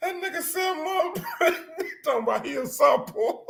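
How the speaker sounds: high, breathless laughing. Real, not acted. Still cracking up at his boy on the live video. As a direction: squeeze it out through the giggles.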